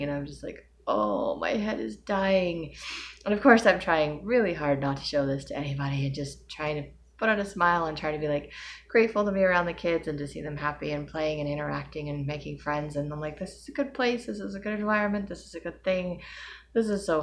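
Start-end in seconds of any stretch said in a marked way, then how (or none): 0.9-2.7 slow, drawn-out pained moan; pained groan. 13.4-16.2 higher, sing-song self-pep talk; strained positive mantra, higher pitched; forced cheer.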